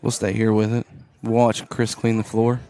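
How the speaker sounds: brisk courtside basketball call; play-by-play. Acted. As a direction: call the game as it happens.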